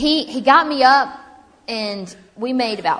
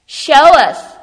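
loud, raised-pitch imitated demand; calling out; 7 semitones higher, 14 dB louder than usual. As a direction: belt it out like an eager audience member. Lady calls out for demonstration.

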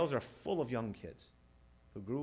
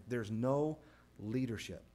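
ordinary delivery

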